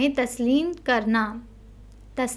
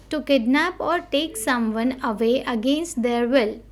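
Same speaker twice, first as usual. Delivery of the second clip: ordinary delivery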